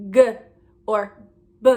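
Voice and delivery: "g" and "b" with the voice on, "guh", "buh"; voiced letter sounds. Shown as a demonstration. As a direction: sound out "g" and "b" as short voiced bursts. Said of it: "There's no sound like".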